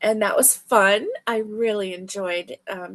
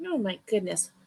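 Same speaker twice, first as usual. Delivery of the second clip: ordinary talk, nothing marked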